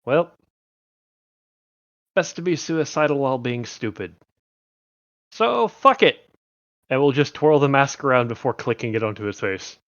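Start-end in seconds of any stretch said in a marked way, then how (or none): 0.0-6.2 character voice. Acted. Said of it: "before going".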